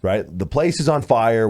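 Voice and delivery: ordinary delivery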